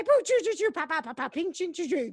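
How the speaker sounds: high, bouncy vocal sound effects; beatbox a jingle with your mouth. Acted. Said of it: "in charge of sound effects".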